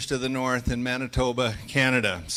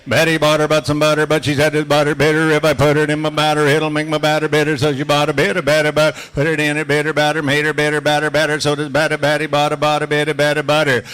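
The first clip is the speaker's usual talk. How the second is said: loud, steady chant of the tongue twister, every word crisp; tongue twister drill; 9 dB louder than usual. Shown as a demonstration. Auctioneer drilling his class in a loud tongue twister chant. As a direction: lead the class through the drill at full voice. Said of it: "we'll do a couple Betty Botters".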